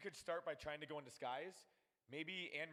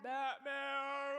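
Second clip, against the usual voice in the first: Batman shout; 11 semitones higher, 7 dB louder than usual. Real, not acted.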